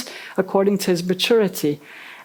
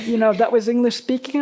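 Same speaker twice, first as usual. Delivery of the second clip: usual speaking voice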